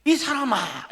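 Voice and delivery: high, loud protest; indignant retort. Acted. Peter brushing off the man who saw him, indignant. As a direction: push back hard at the accuser.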